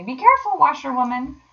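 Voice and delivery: high, loud cry of warning; alarmed warning; warning call. A narrator's reading. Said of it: "calling out warningly".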